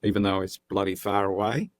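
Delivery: slightly overacted Australian accent. Shown as a demonstration. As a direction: talk like an Australian, laying it on a bit. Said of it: "which I'm probably doing miserably right now".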